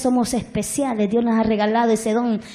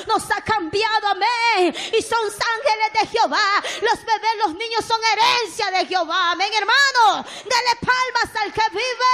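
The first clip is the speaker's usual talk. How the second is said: alzando la voz más aguda, con fervor creciente; predicación ferviente; 8 semitones higher than usual. Real, not acted. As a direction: clama como predicadora encendida. (predicadora ante la iglesia, voz alta y fervorosa)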